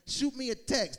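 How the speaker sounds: ordinary talk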